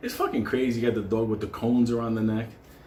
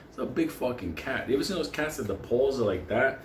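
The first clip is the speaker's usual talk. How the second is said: ordinary delivery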